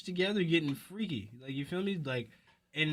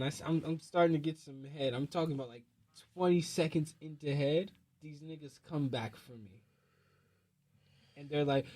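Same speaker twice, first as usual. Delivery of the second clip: usual speaking voice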